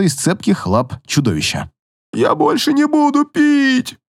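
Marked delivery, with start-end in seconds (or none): 2.1-4.0 high slow desperate cry; panicked shout. Acted. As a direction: swear off booze in terror. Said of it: "закричал он".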